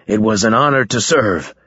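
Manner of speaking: ordinary delivery, nothing marked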